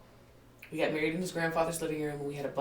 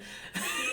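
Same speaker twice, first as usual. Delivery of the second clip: high squeal of laughter; 3 dB quieter than usual. Real, not acted. Laughs at chicken story.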